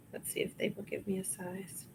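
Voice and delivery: hushed whisper to herself; whispering while checking a tag. Real, not acted. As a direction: murmur it under your breath. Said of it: "whispered aside".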